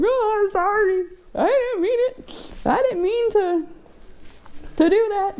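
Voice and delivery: high laughing apology; laughing mock apology. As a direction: apologise while giggling in a high voice. Retells her laughing apology.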